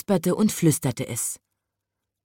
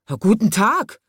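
loud, blustering greeting, pitched up; polternd; 4 semitones higher, 5 dB louder than usual. A narrator's reading. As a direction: boom the greeting back at the visitor. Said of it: "polterte der Elefant". (laut polternd, wie ein Elefant)

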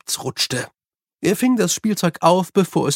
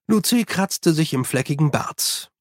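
plain reading voice

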